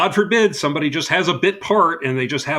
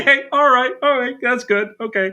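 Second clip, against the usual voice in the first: mock relief; 11 semitones higher, 5 dB louder than usual. Mock concerned bit continues.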